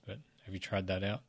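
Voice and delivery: ordinary delivery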